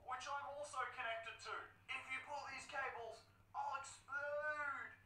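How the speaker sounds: high, strained voice of a frightened captive; panicked Baker voice. Retold or imitated. Baker voiced, tied to bomb.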